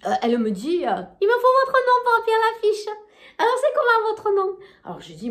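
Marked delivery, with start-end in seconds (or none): 1.2-4.6 high, bright-eyed shop cashier. Retold or imitated. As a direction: play the chirpy cashier up high. Cashier voiced in retold story. (voix de caissière aiguë)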